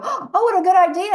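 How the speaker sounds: high, loud mocking of a boardroom man; mock praise. Woman mocking the boardroom man who praises the idea, high and loud. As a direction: ham up the smug colleague praising the idea. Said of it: "And they go".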